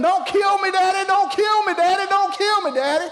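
high, loud, frantic pleading; hammed-up terrified boy hollering for mercy; screaming in panic. Brother begs Daddy not to kill him, acted high screaming. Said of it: "he's hollering and he's screaming".

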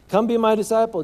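Jesus calling out. Retold or imitated. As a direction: call him off his booth.

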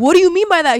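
very loud, high mock outrage; retold offended disbelief. Newcomer challenging the older lady's insult, loudly offended. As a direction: burst out as if insulted, replaying it.

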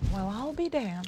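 quiet country drawl; softly, in a country accent; muted astonishment in a country accent. Acted. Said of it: "an accent like that".

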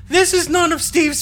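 very high, loud put-on female character voice; Dana voice. Captain answering as the questioned lieutenant, in Dana voice. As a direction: pitch it way up, loud and indignant. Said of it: "That's your Dana voice?".

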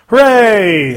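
high, loud mock hooray; mock cheer. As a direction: cheer it up, half teasing. Sarcastic cheer at turning seventeen.

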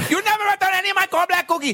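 high, fast mock Chinese accent; Chinese restaurant owner. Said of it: "like a Chinese angry restaurant owner".

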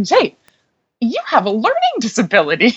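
high-pitched, astonished imitation of a professor; flummoxed professor. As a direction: retell her disbelief, pitched well up. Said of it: "she was just, like, flummoxed".

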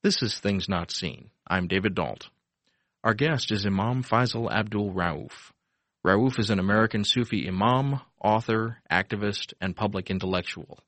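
measured, polished announcer read; smooth public radio announcer voice; radio host intro read. Acted. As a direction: read the show opening like a broadcast intro. Host reads show intro.

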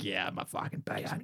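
low menacing rasp, hint of Butcher; Billy Butcher. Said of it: "Do Your Best Billy Butcher impression".

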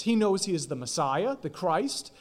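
ordinary delivery

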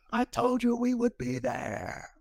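high, slow, tearful quote; a coach choking up in the huddle, high and slow; imitating crying coach. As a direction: voice the coach through tears, slowly. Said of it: "tears streaming down his eyes".

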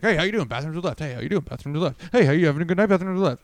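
imitating a sing-song usher on repeat; rapid-fire greeter. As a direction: reel off each greeting without a break. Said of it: "he was talking really fast".

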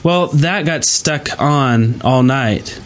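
normal talking voice

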